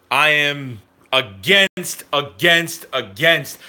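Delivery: slow, each "against" hit separately; emphatic repetition. Real, not acted. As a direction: drag out "I am against, against, against" word by word.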